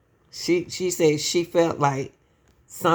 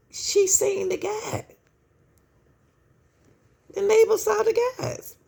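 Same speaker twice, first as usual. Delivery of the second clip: much higher, pointed disbelief; high exasperated emphasis. Real, not acted. Exasperated over the witness.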